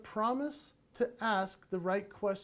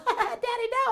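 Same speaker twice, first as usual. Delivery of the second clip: put-on much higher, giggly child's squeal; squealing kids; 6 dB louder than usual. Kids being chased by the raptor, high squeal.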